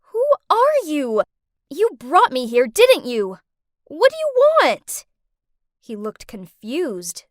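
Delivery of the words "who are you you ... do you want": frightened angry demand. Acted. Confronts her captor.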